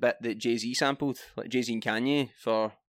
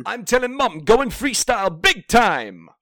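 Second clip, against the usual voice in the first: high, loud shouty grime MC; aggressive MC voice; 9 semitones higher, 10 dB louder, slower than usual. Pretend. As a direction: spit it like a hyped-up garage MC. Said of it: "That's quite aggressive".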